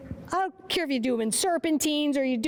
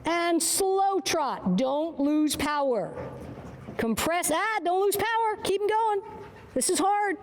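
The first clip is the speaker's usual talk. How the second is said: normal talking voice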